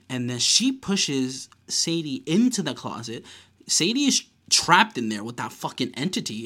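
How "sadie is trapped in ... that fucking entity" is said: ordinary talk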